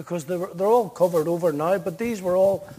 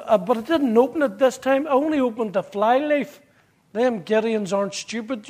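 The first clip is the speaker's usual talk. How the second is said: ordinary talk, nothing marked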